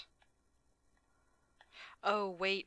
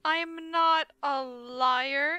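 1 (slow denial); 4 semitones higher, 6 dB louder, much slower than usual. Acted.

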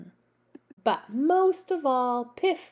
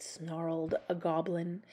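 usual speaking voice

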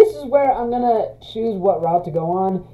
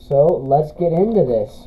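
ordinary talk

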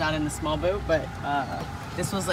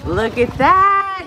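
excited; 10 semitones higher, 8 dB louder, much slower than usual. Real, not acted. Watching a croc jump.

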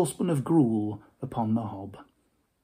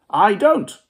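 curt Scrooge; 8 semitones higher, 8 dB louder than usual. Acted. Scrooge denying.

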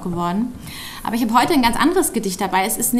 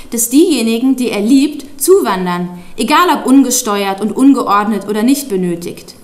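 declaimed poem, firmer and louder than chat; poem recitation; 4 dB louder than usual. Acted. Child reads out father's protest poem to an audience, declaimed. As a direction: recite it line by line, emphatic, with deliberate breaks.

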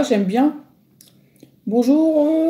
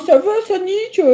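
put-on higher eager kid voice; excited fan; 10 semitones higher than usual. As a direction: greet your idol eagerly. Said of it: "Il me fait". (petit fan enthousiaste, voix aiguë)

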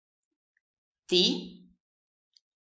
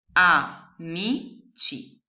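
very slow "Amici" spelled out as A-MI-CI; syllable by syllable; 5 dB louder, much slower than usual. Shown as a demonstration. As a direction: sound out "Amici" slowly. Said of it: "La prima parolina è". ("Amici" scandito lentamente)

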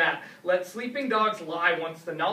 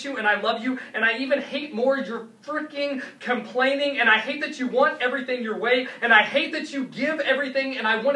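driving, higher rant with no let-up; venting frustration; 3 semitones higher than usual. Acted. Poet laying it all out to someone loved and hated, venting frustration. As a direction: let the complaints pour out in one run.

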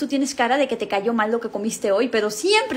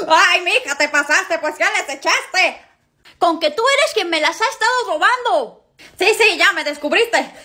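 gasping shock; 6 semitones higher, 3 dB louder than usual. Acted. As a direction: gasp high and loud, then burning agitation. Spicy candy reaction, caught.

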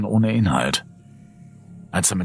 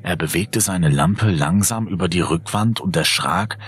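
usual speaking voice

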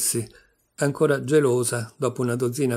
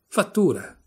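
higher, raised exclamation; narrator's contained acted yell; 6 semitones higher, 4 dB louder than usual. A narrator's reading. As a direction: cry it out, short of a full scream. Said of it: "urlò".

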